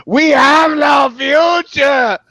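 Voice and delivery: drawn-out shout, high and loud; slow, dramatic shouted declaration; shouted breakup line. Retold or imitated. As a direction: yell it like ending a relationship. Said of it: "like I said when I was breaking up with some woman".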